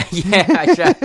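high, loud bashful laugh. Real, not acted. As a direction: laugh it off modestly. Laughs at being called bright.

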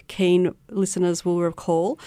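ordinary talk, nothing marked

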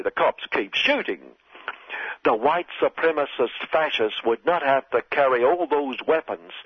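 normal talking voice

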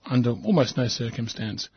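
normal talking voice

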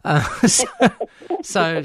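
real laughter breaking through the words; laughing. Real, not acted. Host laughing at the meme, talking through real laughter. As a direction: laugh while trying to carry on.

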